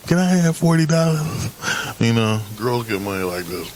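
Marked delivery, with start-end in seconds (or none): 0.1-1.5 high, loud mock girly request; smiling girl's plea. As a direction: imitate a girl asking with a smile. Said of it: "I can smile like this and go".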